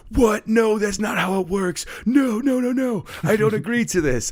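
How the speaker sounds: high, loud panicked shouting of no; kid's frantic protest. Retold or imitated. Student yelling at his teacher that he won't be married. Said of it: "he was like".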